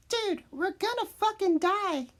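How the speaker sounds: high, strained squeal of dread; panicked kid. Retold or imitated. East to his friends before the game, panicked high voice.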